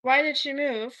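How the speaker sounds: ordinary talk, nothing marked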